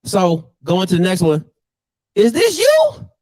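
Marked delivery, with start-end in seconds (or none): 2.2-3.1 high-pitched, incredulous question; incredulous high-pitched roast; mocking disbelief. Real, not acted. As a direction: pitch it way up, ribbing him. Mocking a man's picture.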